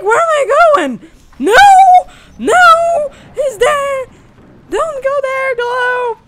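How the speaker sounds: high, loud frantic yelling; panicked shouting. Real, not acted. Player fleeing in horror game, frantic high shouting. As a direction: yell in panic while fleeing.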